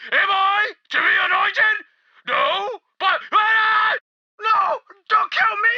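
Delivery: high, crying plea; hope collapsing into terror; panicked begging. Acted. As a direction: stammer and beg for your life. Follower realises he will be killed.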